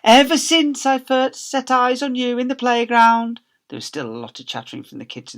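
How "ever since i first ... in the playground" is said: higher, slow romantic declaration; Mister Lovey swooning; lovestruck proposal. Acted. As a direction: pour out his heart. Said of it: "he replied".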